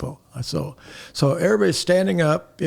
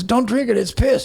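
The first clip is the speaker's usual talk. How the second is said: high-pitched mock warning; passed-along warning; 9 semitones higher than usual. Guy hands it to the next one, warning him. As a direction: imitate each guy relaying it, voice raised.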